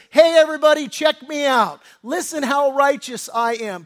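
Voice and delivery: prideful self-exaltation. Acted. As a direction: brag to the whole room, loudly. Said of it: "The attitude, the idea is".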